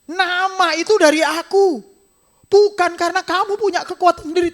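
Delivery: high, loud voice of God reminding Peter; voicing God. Preacher voicing God reminding Peter, high and loud. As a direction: speak as the Lord, lifted and firm. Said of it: "Seolah-olah Tuhan ngomong gini".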